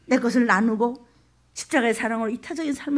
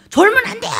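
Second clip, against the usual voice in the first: much higher and loud, keep him awake; urgent shout; 11 semitones higher, 9 dB louder than usual. Acted. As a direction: bark it to stop him drifting off. Rescuer keeping victim awake.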